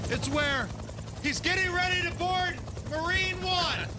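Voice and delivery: high, strained shout over a helicopter; shouting over noise. Pretend. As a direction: call out as if over roaring rotors.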